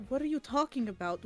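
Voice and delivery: ordinary talk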